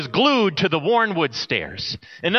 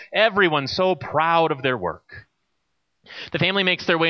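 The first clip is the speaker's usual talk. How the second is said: ordinary delivery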